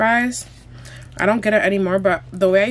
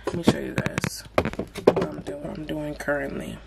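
whispering; 4 semitones lower, 6 dB quieter than usual. Real, not acted. Whispered aside while seasoning.

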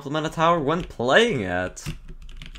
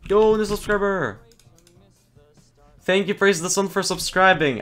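excited; 7 semitones higher than usual. Real, not acted. Excited shout-out to new subscriber.

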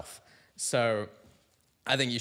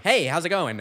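loud, higher failed comic-host imitation; bad John Mulaney; 6 semitones higher, 8 dB louder than usual. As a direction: overdo a smooth stand-up greeting and miss. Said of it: "speak like John Mulaney".

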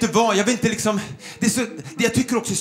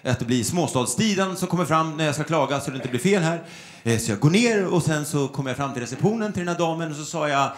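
ordinary talk, nothing marked

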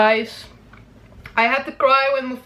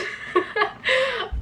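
high giggle; 13 semitones higher, 5 dB quieter than usual. Real, not acted. Laughs at her plan.

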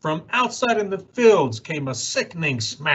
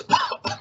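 a small dry cough. Acted. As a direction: cough once, lightly. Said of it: "He coughed and he whiffed".

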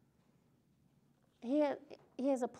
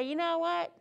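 mock angry rant, a bit louder; scolding father; 4 dB louder than usual. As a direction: chew him out like a fed-up dad. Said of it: "he didn't say".

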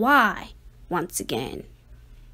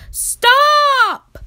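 big high shout; yelled stop; 12 semitones higher, 10 dB louder than usual. Acted. Parents yelling at Ada to stop, a loud high shout. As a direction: yell it to halt her. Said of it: "her parents yelled".

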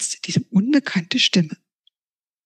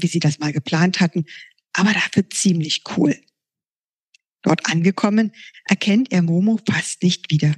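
ordinary delivery, nothing marked

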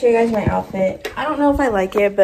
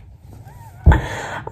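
high squealing laugh; 5 dB louder than usual. Real, not acted. Squealing laugh over braces coming off.

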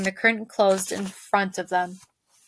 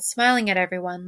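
plain reading voice

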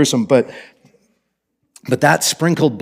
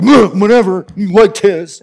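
higher, louder mock sulk; sulky Cain; 6 semitones higher, 4 dB louder, slower than usual. Cain sulking at God over his brother's offering, mock petulant. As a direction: toss it off like a jealous brother. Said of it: "And Cain's like".

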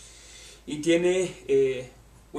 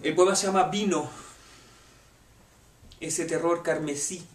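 normal talking voice

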